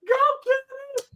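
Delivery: high, cheering "Go, Kenny" in a wife's voice; imitating wife's high cheer; mock cheering wife. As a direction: squeal it like the wife cheering from the stands. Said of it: "She's like".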